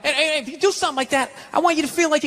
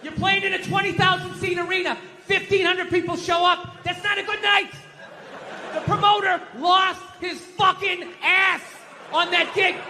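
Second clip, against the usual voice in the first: yelling at the TV; 8 semitones higher than usual. Real, not acted. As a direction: yell it like you're shouting at the TV. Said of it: "screaming at the T V".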